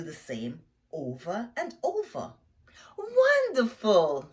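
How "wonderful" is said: slow, high, loud praise; drawn-out delighted teacher praise; praising teacher. Acted. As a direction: stretch it out to reward the pupils. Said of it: "says the teacher".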